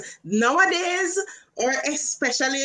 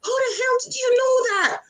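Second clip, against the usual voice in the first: high, amazed mock disbelief; shocked disbelief; 12 semitones higher than usual. Retelling the shocked manager, high amazed disbelief. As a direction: act out a stunned man. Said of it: "he was, like, shocked".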